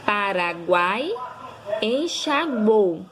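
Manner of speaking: Paraguai and enxaguou drawn out syllable by syllable; slow syllable by syllable. Shown as a demonstration. Teacher to pupils, slow syllable-by-syllable example words. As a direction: sound out each word slowly. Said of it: "É o caso de".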